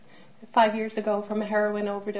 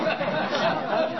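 bright burst of laughter; 5 semitones higher than usual. Real, not acted. Mother laughing about her daughter's odd stories, a bright laugh.

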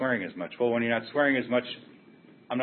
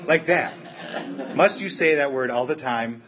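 higher and louder, a retold scolding from a Scottish grandma; scolding grandma quote; 6 semitones higher, 8 dB louder, slower than usual. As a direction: quote the old lady snapping back, much louder. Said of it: "She goes".

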